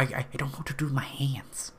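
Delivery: breathy whispered movie quote; Ricky Bobby whisper. Retold or imitated. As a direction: whisper it like the film line. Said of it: "to quote Ricky Bobby".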